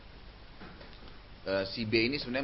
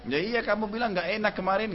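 much higher, a wife retorting; mock sulky wife; 11 semitones higher, 4 dB louder than usual. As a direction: toss it back like a miffed wife. Istri membalas suami.

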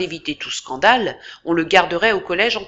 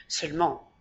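softer, lower hushed word; 5 dB quieter than usual. A narrator's reading. Fencing master confiding to Daniel in a low voice. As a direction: drop the voice before a secret. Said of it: "me dit-il à voix basse".

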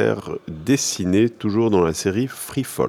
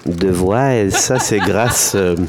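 squeaky helium-changed voice. Real, not acted. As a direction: talk through helium, laughing. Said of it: "grâce à un ballon d'hélium".

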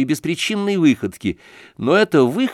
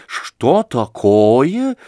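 очень медленно, с изумлением; не верить своим ушам; протяжно, в ужасе; 3 dB louder, much slower than usual. A narrator's reading. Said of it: "в ужасе протянула". (мать не верит ушам)

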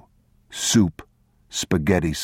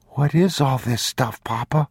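put-on high, wondering child's voice; a small boy amazed; young boy; 8 semitones higher than usual. A narrator's reading.

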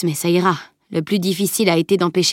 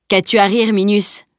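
tonnant; 2 semitones higher, 4 dB louder than usual. A narrator's reading. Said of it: "tonna Bjorn".

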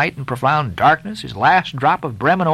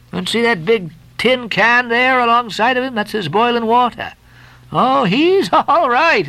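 higher, joking sailor, breaking into a laugh; laughing Stubb; 7 semitones higher than usual. Acted.

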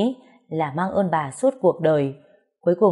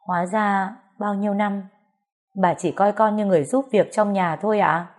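plain reading voice

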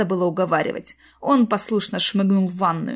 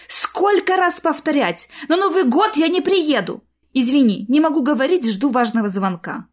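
irritated exclaim; 6 semitones higher, 3 dB louder than usual. Acted. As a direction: snap at mother in annoyance. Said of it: "раздражённо воскликнула".

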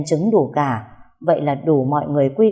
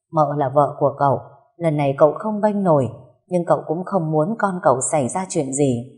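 usual speaking voice

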